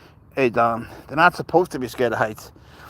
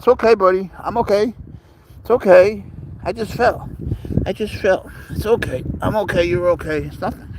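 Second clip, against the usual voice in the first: high, light reassuring pet voice; voicing the pet; 6 semitones higher than usual. Voicing the cat for the viewers, high and reassuring. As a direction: speak for the cat who just fell, keeping it cheerful.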